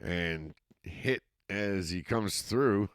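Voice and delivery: steady live football call, rising with the action; play-by-play commentary. Acted. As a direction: call the game as it happens. Play-by-play resumes.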